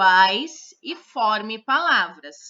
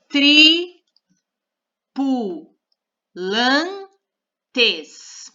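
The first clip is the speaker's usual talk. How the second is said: "tripulantes" stretched very slowly and said louder; drawn-out word; 3 semitones higher, 6 dB louder, much slower than usual. Shown as a demonstration. Teacher spells out the new word while writing it, very slowly. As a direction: draw out every syllable of "tripulantes" as you type it. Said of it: "a gente vai formar a palavra".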